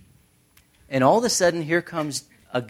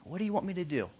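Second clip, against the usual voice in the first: soft, higher, gentle question; tender servant's offer; 5 semitones higher, 11 dB quieter, much faster than usual. Acted. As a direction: offer help in a small, tender voice. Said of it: "the words of service".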